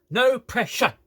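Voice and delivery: PRESSURE pronounced to its last syllable, high; full word. Shown as a demonstration. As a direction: pointedly finish both syllables of PRESSURE. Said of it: "to finish the fucking word".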